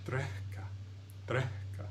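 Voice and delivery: Drekka whispered with breath before the kk; pre-aspirated k. Shown as a demonstration. Teacher demonstrating the breath in drekka, pre-aspirated. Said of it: "Hear that breath?".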